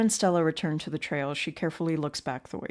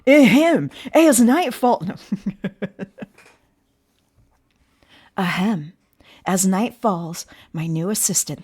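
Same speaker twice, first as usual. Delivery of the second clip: loud, hammed-up YouTuber intro; 7 dB louder than usual. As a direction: announce it like a clickbait host. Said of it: "let's get our best YouTuber voice on".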